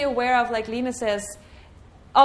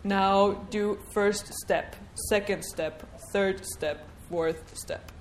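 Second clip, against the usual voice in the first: slow, lower, solemn divine command; a hint of an authoritative deity; commanding God; 3 semitones lower, 4 dB quieter, slower than usual. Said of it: "God tells you".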